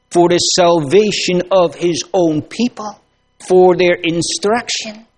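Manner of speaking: preacher pleading to his congregation, impassioned; impassioned preaching. Acted. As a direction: preach it out, lifting toward the climax. Preacher builds to climax.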